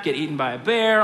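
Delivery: ordinary delivery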